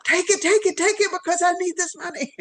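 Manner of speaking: high, eager begging voice; pleading client. Retold or imitated. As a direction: imitate the client who pleads for the money. Client begging her to accept.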